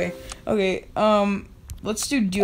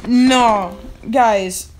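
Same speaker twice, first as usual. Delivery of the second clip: frustrated shout; 7 dB louder than usual. Real, not acted. Just died again.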